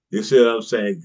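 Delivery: ordinary delivery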